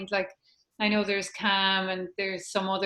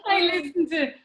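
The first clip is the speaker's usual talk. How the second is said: high, giggling admission through laughter; laughing; 9 semitones higher, 5 dB louder than usual. Real, not acted. To her host, laughing that Andy is her voice. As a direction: laugh the words out, pitched way up. Admits she listens to Andy.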